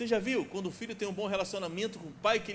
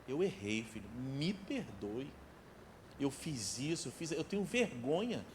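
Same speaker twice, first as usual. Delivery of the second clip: humble apology; 7 semitones lower, 8 dB quieter than usual. Acted. Father asking his son's forgiveness, soft humble apology. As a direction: hush down and own the mistake.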